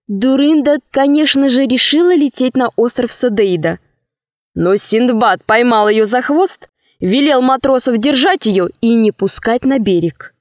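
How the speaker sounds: ordinary delivery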